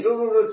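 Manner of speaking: rolling mock motor sound; imitated machine whirr. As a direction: buzz it like a running machine. (模仿机器噜噜转动声)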